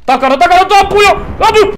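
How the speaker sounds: loud, rising battle call; frantic shouted alert; urgent warning shout. Real, not acted. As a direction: yell out danger to a teammate. Urgent warning under fire.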